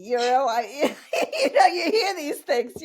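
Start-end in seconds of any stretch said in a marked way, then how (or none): 1.1-2.8 high, louder words through real laughter; laughing. Real, not acted. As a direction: laugh off the silly fear. Laughing at dead body fear.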